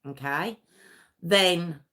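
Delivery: ordinary talk, nothing marked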